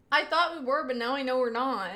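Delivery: normal talking voice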